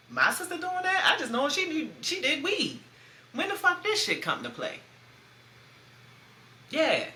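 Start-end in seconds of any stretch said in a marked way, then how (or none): 0.1-4.8 high, incredulous; shocked, pitched way up, can't believe it; stunned big sister hearing the news; disbelief. Retold or imitated. Disbelief at sister's drug use.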